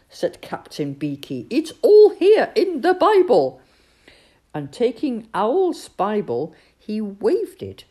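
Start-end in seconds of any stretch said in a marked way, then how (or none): 1.5-3.6 high, loud insistence; raised voice. A narrator's reading. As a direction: spell it out loudly for a deaf listener. Captain raising voice for deaf Badger.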